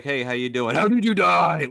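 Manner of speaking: tossing a grim question out as if small talk; mock bar opener. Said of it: "showing up at a bar and be like".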